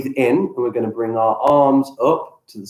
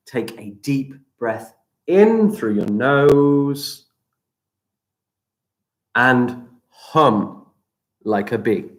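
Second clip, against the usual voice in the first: guided breathing; slower than usual. Acted. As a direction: guide the breath calmly, stretching words. Leads breathing exercise.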